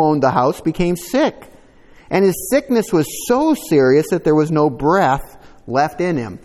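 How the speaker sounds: solemn Bible reading; scripture reading. Acted. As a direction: read the passage out to the church. Reads scripture aloud.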